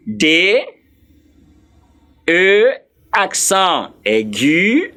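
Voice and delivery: D E accent aigu C E M B R E, each letter named in French with long gaps; French letter names. Shown as a demonstration. Teacher repeats the Frenchman's spelling, D E C E M B R E in French letters. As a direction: spell D E C E M B R E slowly as day, uh, say, uh, em, bay, air, uh. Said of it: "the way the Frenchman will spell it".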